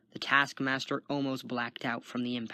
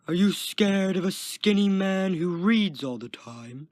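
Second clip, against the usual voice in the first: loud, raised taunting call; louder yell; 6 semitones higher, 6 dB louder than usual. A narrator's reading. Guard walking away taunts Kenton, yelling. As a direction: holler the taunt down a hall. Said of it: "the guard yelled louder".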